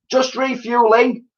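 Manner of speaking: put-on high, bright child's call; little girl calling out. A narrator's reading. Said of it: "called out Elsie".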